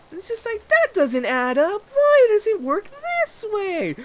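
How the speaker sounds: very high, put-on squeaky voice; high cartoonish questioning voice; mock falsetto. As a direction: play a naive voice asking questions.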